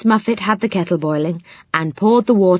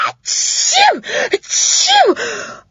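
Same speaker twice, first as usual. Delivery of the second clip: sneezing; much slower than usual. A narrator's reading. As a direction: sneeze twice, big and high. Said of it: "The spider sneezed".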